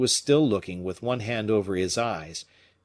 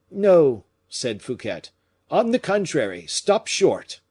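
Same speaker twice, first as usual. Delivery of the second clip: sharp high command; 7 semitones higher, 6 dB louder than usual. A narrator's reading. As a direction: order them to stop at once. Fouquet orders boat stopped.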